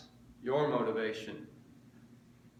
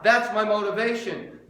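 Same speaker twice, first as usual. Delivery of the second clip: emphatic declaration; 7 semitones higher, 8 dB louder than usual. Acted. Declared, then repeated softly.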